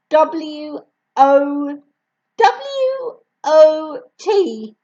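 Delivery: put-on high child's voice spelling it out; small boy spelling. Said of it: "answering too quickly".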